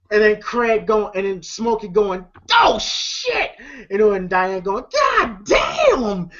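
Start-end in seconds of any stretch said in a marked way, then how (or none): 2.5-3.5 high-pitched imitated exclamation; mock shocked cry. Retelling a movie fight's onlooker reaction, mock shocked cry. 4.9-6.3 high, drawn-up imitated exclamation; mock stunned cry. Onlooker reacting to the body slam, imitated stunned cry.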